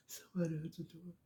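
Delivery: mother copying her son's low, soft mumble. Retold or imitated. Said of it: "he talks really low".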